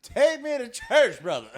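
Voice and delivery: high, loud mock gospel shout; hammed-up preacher call. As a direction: belt it like a revival preacher.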